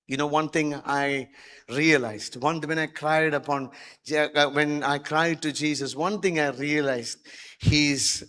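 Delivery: usual speaking voice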